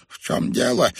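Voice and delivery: ordinary delivery, nothing marked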